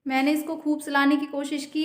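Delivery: ordinary talk, nothing marked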